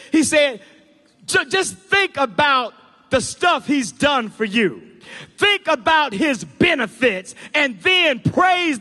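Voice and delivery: high, loud, emphatic sermon climax; full-force preaching. Real, not acted. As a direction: preach with mounting force, stretching key words.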